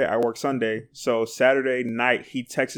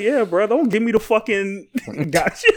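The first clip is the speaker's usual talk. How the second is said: higher, teasing coworker voice; lightly voiced annoyed coworker; mock coworker; 7 semitones higher than usual. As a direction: tease him like the coworker did. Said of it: "he's like".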